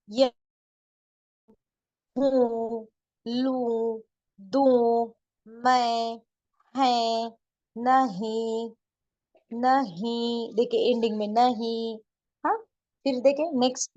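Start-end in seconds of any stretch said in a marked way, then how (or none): 2.2-12.0 words from हूँ to नहीं read singly, each final vowel long and nasal; noon ghunna endings. Shown as a demonstration. Urdu teacher reading a word list for learners, nasal endings. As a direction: model each word slowly, ending in the nose. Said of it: "नाक में जाकर छुप कर".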